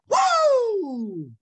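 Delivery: loud high cheer of joy; excited whoop. Real, not acted. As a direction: let out a big woo. Host cheers opening the show.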